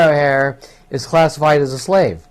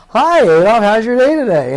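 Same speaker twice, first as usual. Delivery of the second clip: cheery greeting; 7 semitones higher, 3 dB louder than usual. Acted. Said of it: "walking up to Adolf Hitler and saying".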